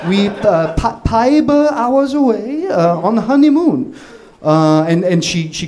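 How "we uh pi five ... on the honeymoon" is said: higher-pitched, halting imitation Korean accent; thick Korean accent. [name] phoning to ask for a room, thick put-on Korean accent. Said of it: "do your, like, thickest, you know, Korean accent".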